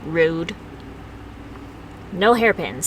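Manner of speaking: ordinary talk, nothing marked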